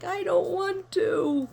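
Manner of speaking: put-on slow, high little-kid whine; stretched-out high child refusal; childish whine. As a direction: whine it like a picky four-year-old.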